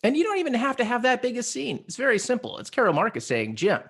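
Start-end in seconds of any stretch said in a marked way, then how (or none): none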